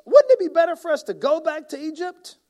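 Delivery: high, loud mocking whine; hammed-up whine; mock complaint. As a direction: sneer it like a grumbler. Reading complaint as Israelites.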